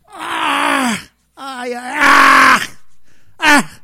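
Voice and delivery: loud shocked ah and ay cries. Acted. Healer crying out loudly after smelling the potion. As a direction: cry out as if the scent burns.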